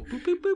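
high beeping dial-tone sounds; phone dial beeps. Pretend. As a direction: beep like a phone dialing. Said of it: "we like calling people".

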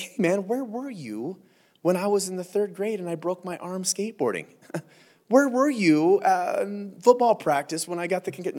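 high, petulant mock accusation; mock whiny complaint. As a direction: whine like a kid holding a grudge. Mock grievance quote to angels.

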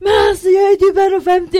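very high, loud, fast outburst; exasperated shouted complaint, retold at full tilt; angry protest. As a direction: yell it in acted outrage. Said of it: "Jag var så himla arg".

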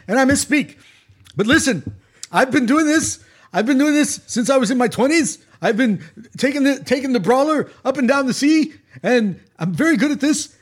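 emphatic, halting stump-speech captain; Bernie Sanders impression. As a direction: lecture the passengers like Bernie Sanders. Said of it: "this Bernie Sanders guy".

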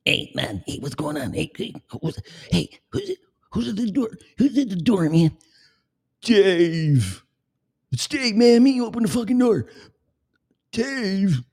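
high stoner voice yelling through the door; [name]. Retold or imitated. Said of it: "That's how [name] came on".

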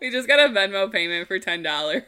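reading out through laughter; laughing. Real, not acted. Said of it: "laughing while reading".

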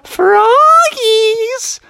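high, drawn-out creepy call; taunting goblin singsong. Acted. Anne at the door calls hidden frogs, high drawn-out taunt. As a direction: lure them out with stretched wicked syllables.

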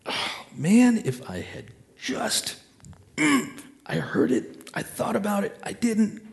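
hammed-up anguish, pitched very high; acted regret. Someone who ignored the little voice, hammed-up regret. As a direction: drag out the mock regret in a high, strained wail. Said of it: "and be in that place of".

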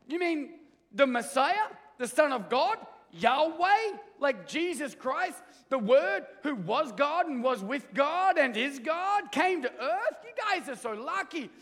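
can't contain the wonder; blown away alien. Retold or imitated. Alien marvels to Michael that God came. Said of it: "blown away".

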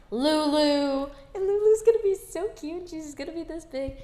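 high, loud gushing over the name Lulu; excited squeal. Real, not acted. Kid gushing to listeners about her dream dog, excited squeal.

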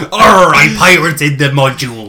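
loud hearty pirate growl. Pretend. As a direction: play it like a cheeky pirate. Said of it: "If you say it in a pirate's voice".

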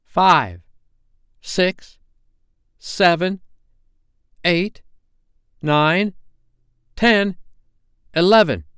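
high-pitched monotonous count; same high note on each number, spaced apart; Slightly. A narrator's reading. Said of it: "Slightly monotonously counting".

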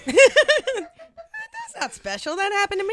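high, loud, laughing brag; laughing boast. Real, not acted. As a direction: crow it through laughter, slowly. Laughing boast about [name].

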